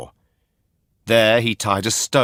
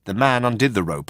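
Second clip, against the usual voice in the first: usual speaking voice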